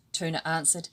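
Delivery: usual speaking voice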